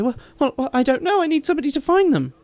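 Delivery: high, fast, stammering reply; flustered before the guards; flustered farmer woman. Acted. Flustered farmer woman answers the guards, high and fast.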